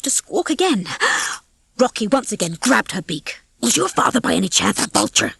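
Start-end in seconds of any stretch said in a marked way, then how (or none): 3.6-5.3 sour. A narrator's reading. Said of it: "he said sourly".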